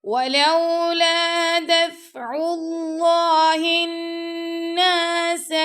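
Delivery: high, loud melodic tajweed chant; teacher reciting the whole verse; Quran recitation. Shown as a demonstration. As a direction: sing the verse out in full.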